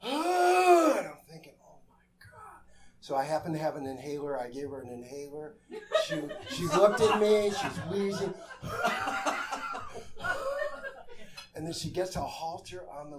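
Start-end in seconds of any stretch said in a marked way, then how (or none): 0.0-2.6 a breathless, unvoiced Oh my God, high and slow; panicked gasp. Telling the audience his panic at the wheezing woman. 8.4-11.5 high laugh. Real, not acted. Storyteller laughing at the wheezing visitor.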